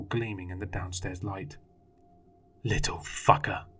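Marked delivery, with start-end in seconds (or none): 2.6-3.7 growled. A narrator's reading. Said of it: "he growled".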